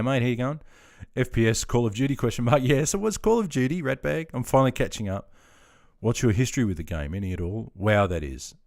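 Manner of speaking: usual speaking voice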